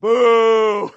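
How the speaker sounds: long, loud, high booing. Retold or imitated. Preacher reacting aloud to the book, booing loudly. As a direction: heckle it with a drawn-out boo. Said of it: "I literally out loud said".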